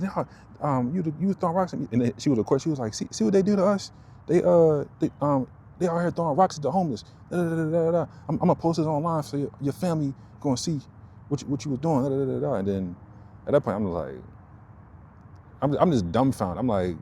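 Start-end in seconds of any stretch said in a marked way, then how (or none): 0.0-1.9 high accusing woman's voice, far higher; mocking accuser. Imitating the woman who accused him, high and sharp. 2.9-12.5 high, pitched-up mock of her rant; high ranting woman. Imitating the woman ranting at her phone camera, high.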